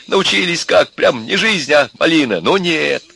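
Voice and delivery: high-pitched sarcastic grumbling outrage; indignant rant. A narrator's reading. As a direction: keep railing at those freeloaders. Father continues his angry rant. (возмущённая тирада отца)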